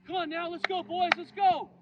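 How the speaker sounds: high, loud shout to rally the team; loud cheering. Retold or imitated. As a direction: fire up the dugout with a big yell. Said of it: "Cheered on his teammates".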